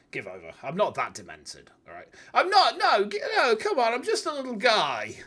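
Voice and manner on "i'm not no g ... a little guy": high, loud whiny protest. Acted. Defending herself to chat with a high, loud whine. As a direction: protest your innocence like a wounded little sapling.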